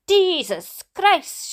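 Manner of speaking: high, slow startled shout; dad's shout. Acted. Dad shouting on seeing the crashed car. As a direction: cry out in shock at the crash. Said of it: "shouted my dad".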